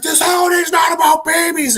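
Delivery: high, strained villain shout; hammed-up yell of a villain losing it; acted freak-out. Narrator voices villain wrecking Christmas things, acted freak-out shout. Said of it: "like, freaking out and".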